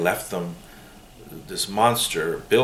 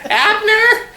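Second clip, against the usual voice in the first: shrill-high screeching call of a nosy neighbor, much higher and louder; Mrs. Kravitz; 8 dB louder than usual. Retold or imitated. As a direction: shriek the husband's name like the nosy sitcom neighbor. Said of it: "a character called Missus Kravis".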